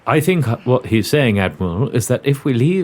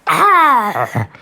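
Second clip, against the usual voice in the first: startled cry; 8 semitones higher, 4 dB louder than usual. Acted. Cry after parrot.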